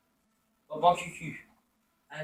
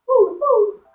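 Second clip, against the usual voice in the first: 1 high hoots; 9 dB louder than usual. Real, not acted. Hooting while attacking.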